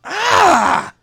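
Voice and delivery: excited yelp. Real, not acted. Excited reaction to a pick.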